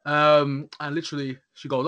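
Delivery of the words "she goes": ordinary talk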